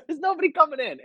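high, pleading with the dog; exasperated scolding. Retold or imitated. Owner reasoning with his dog, high exasperated. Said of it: "I go".

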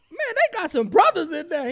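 very high, loud exclamation; hammed-up excited yell; excited surprise. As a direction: blurt it out in amazed delight. Retold delighted surprise at caller.

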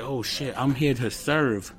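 hushed, dawning self-talk; retold quiet realization. Man recalls realizing his purpose, hushed. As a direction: drop your voice as it sinks in.

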